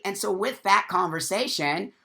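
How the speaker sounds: usual speaking voice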